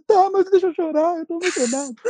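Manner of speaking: high, tearful pleading; mock blubbering, overcome with emotion; imitated crying. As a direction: plead through put-on sobs. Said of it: "não precisa chorar não".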